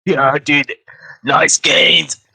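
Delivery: loud, high bro voice; gym bro. Pretend. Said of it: "more like a gym bro".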